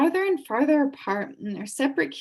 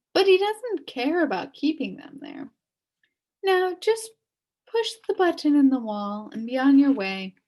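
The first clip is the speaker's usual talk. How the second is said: higher, brisk witch voice; 5 semitones higher, 3 dB louder than usual. A narrator's reading. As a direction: wave his worries away. Witch advises Milo briskly.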